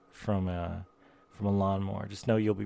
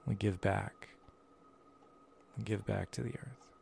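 airy breathy mystical healer; 6 dB quieter than usual. Acted.

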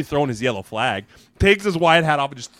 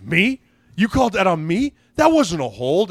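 higher, louder disbelief; acted indignant protest; 5 semitones higher, 5 dB louder than usual. Teammate disputing a holding flag with the referee, imitated indignant protest. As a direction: protest the call in mock outrage.

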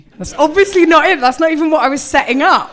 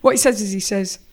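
ordinary talk, nothing marked

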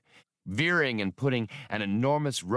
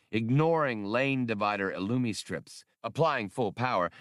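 usual speaking voice

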